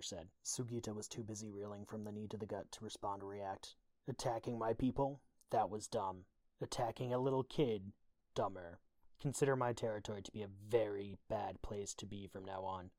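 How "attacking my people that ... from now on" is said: louder, emphatic threat; stern warning. A narrator's reading. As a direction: lay down the law, hitting the key words hard. Skitter warns the beaten attackers.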